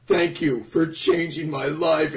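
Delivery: mock tearful gushing. Fanboy thanking Oda for changing his life, mock tearful gushing. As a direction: pretend to be overcome with gratitude. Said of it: "and crying and shit".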